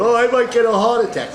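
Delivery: high, fast mock panic; mocking scared voice. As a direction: play up a scaredy-cat whine. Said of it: "you laugh at people going".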